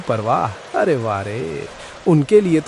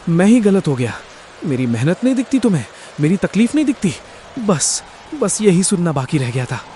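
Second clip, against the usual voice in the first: hurt anger; 5 semitones higher, much faster than usual. Acted. Husband's angry retort to wife.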